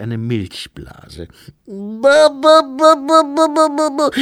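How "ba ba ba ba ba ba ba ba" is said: high, loud infant babbling; piping, repeated baby syllables; baby babble. A narrator's reading.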